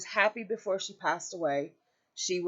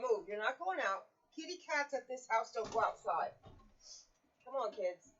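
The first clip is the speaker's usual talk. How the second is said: higher-pitched voice coaxing the dogs and cats; pet talk; 6 semitones higher, 6 dB quieter than usual. Real, not acted. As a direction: coax the pooches along, higher and softer. Talking to her pets.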